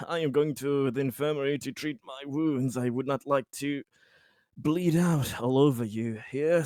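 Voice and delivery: ordinary talk, nothing marked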